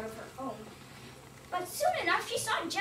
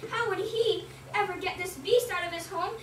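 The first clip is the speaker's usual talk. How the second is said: ordinary delivery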